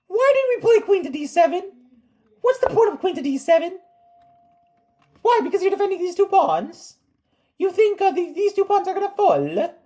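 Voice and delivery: high-pitched, hammed-up Italian voice; mock Italian accent. Chess coach questioning a queen move, hammed-up Italian accent. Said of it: "don't know why I sound Italian".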